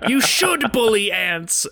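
high, shouted theatrical outburst, then slow; imitating Sander Cohen. [name] voices Sander Cohen's outraged refusal in a high shout. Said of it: "He said".

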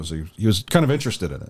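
ordinary talk

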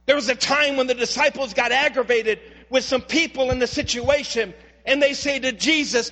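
ordinary delivery

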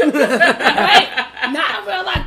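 drawn-out warning, very slow; very slow emphatic. Real, not acted. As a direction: stretch each word out as a warning.

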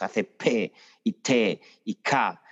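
slow, with a strong puff of air after P, T and K; aspirated stops. Shown as a demonstration. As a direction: spell out "P y T y K" slowly, breathing hard after each stop. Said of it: "aspira mucho las oclusivas". (oclusivas muy aspiradas, lentas)

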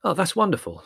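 high mock delight; exasperated irony; sarcastic. Larry's sarcastic reaction.

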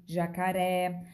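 ordinary talk

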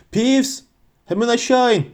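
high-pitched shout at contained volume; shouted order. A narrator's reading. McGonagall yells up at the ghost, high commanding call. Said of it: "diye bağırdı".

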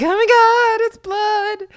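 high, loud mock horror; exaggerated high-pitched freak-out; shocked guys. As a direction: mimic grossed-out guys shrieking. Mocking squeamish guys' shock.

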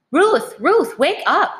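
mother's anxious cry, pitched high; frightened urgent wake-up call. A narrator's reading. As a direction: call out urgently to rouse a sleeper. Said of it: "her mother sound so frightened".

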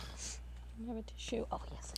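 whisper. Real, not acted. Whispered aside.

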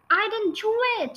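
put-on high desperate girl's scream. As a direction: cry out the denial, pitched high. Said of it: "she screamed".

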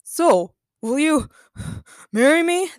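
panting between phrases while running; broken into gasped bursts, rising in pitch; out of breath. A narrator's reading. Daniel proposing while fleeing the robot, out of breath. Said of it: "Daniel asked while running and out of breath".

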